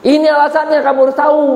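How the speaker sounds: high-pitched, emphatic tempter's pitch; insistent devil persuading. Acted. Devil convincing Eve of God's reason for forbidding, insistent. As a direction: push it high and urgent, desperate to convince. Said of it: "iblis mencoba meyakinkan itu".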